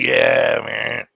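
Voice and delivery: lightly imitating a laid-back talker, voice dropping on "man"; a hint of his drawn-out, low-key way of talking; Doc impression. Joking about how a friend talked, lightly imitated voice. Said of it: "he even talked like Doc a little bit".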